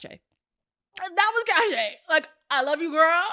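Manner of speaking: high, slow, drawn-out repeat; dramatic repeat. Shown as a demonstration. Speaker repeating the punchline about Cache, dramatic and high.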